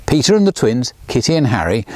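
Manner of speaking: usual speaking voice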